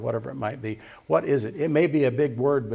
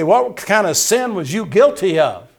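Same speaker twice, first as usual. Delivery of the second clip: imitated challenger; 7 semitones higher, 10 dB louder than usual. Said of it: "And say".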